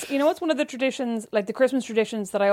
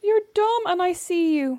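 higher, slow mock child taunt; mock creepy kid; 8 semitones higher, slower than usual. Sister teasing by repeating the nephew's taunt, higher and slow. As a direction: parrot the kid's taunt slowly. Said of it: "I'm an absolute creep".

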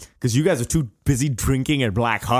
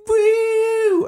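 very high, loud squealing woo; party woo; 4 dB louder than usual. Retold or imitated. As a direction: whoop like a bachelorette party.

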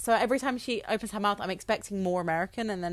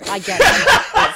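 laughing; 12 semitones higher, 12 dB louder, much slower than usual. Real, not acted.

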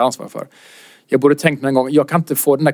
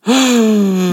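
shocked gasp; 6 semitones higher than usual. Retold or imitated. Comic stunned by rude couple.

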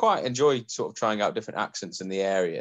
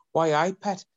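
imitated Geordie catchphrase, lifting high at the end; Geordie accent; 10 semitones higher, 3 dB louder than usual. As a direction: coach someone with a Geordie example. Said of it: "help me do the Geordie accent".

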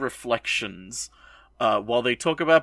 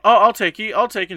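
excited; 8 semitones higher, 8 dB louder than usual. Retold or imitated. Classmates eagerly volunteer.

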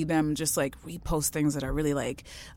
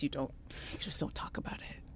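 whispered aside; 4 semitones lower, 16 dB quieter than usual. Acted. Hushing the taboo while telling the podcast hosts. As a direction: drop to a whisper. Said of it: "hushing the taboo".

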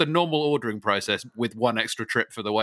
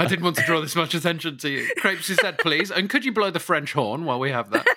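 mock Tom; 7 semitones higher than usual. Voicing Tom's over-the-top order.

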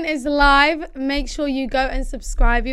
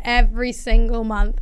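usual speaking voice

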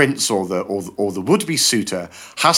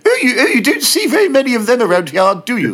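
high, pinched little voice through puckered lips; pursed lips; 12 semitones higher, 5 dB louder than usual. Shown as a demonstration. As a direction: squeeze the mouth tiny and squeak it out, laughing. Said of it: "put your lips together like a dog's bummo".

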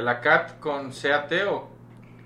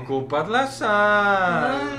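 slow, drawn-out Guadalajara singsong, higher; Guadalajara accent; 7 semitones higher, much slower than usual. Retold or imitated. Mimicking Guadalajara speech for friends, slow singsong accent. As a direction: stretch it lazily like a Guadalajara local. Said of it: "Así son, güey".

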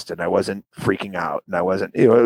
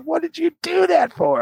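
high, whiny exasperated question; exasperated friend. Retold or imitated. Quoting his friend blaming him, high and whiny. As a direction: whine it like the annoyed buddy. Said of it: "He's like".

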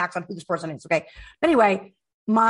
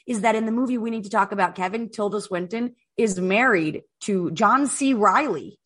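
normal talking voice

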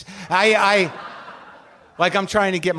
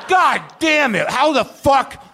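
slower, high, loud shout of delight; drawn-out ecstatic yell; 8 semitones higher, 4 dB louder, slower than usual. Pretend. Acting out first bite of the cake pop, slower ecstatic shout. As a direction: stretch the cry out, still amazed. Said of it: "the experience I had when I ate my first cake pop".

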